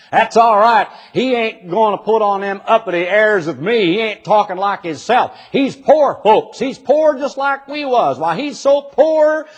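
lightly voiced Southern country man bragging. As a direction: tell it like a poor country boy. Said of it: "He says".

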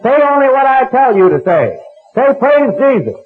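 high, loud barking order; mock furious healer. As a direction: snap him silent like a tyrant preacher. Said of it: "healer silences old man".

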